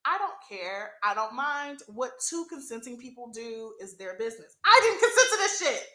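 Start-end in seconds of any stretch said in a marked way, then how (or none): 4.7-5.9 higher and louder, indignant; outraged. Real, not acted. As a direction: let real outrage burst out. Outraged at being peed on.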